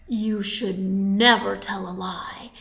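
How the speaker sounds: slow, low, breath-heavy scolding; grave, hushed warning. Acted. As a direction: scold slowly in a low hush. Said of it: "they were angry. Very angry".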